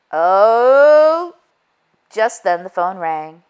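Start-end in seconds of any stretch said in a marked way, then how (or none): none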